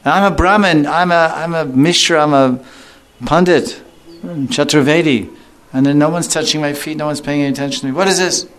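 high, self-important complaining priest; indignant Brahmin. Retold or imitated. Envious Brahmin indignant nobody touches his feet, high whiny. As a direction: whine about being ignored, puffed up.

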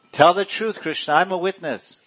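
high, insistent monkey witness; mock accusing. Monkey witness confronting Krishna, mock accusing and high.

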